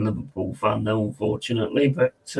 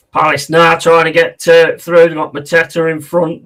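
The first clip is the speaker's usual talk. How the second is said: louder live football commentary; live play-by-play; 4 semitones higher, 9 dB louder than usual. Real, not acted. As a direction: call the attack live, with raised volume.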